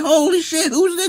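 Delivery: high startled holy shit; surprised exclaim. Retold or imitated. Watching Westworld and spotting the man, high surprised cry. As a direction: yelp it in shock. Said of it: "I said".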